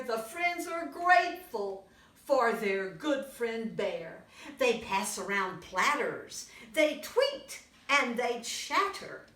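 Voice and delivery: put-on lively read-aloud for little kids; storybook reading. A narrator's reading. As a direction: tell it brightly to a young audience. Reads picture book to children.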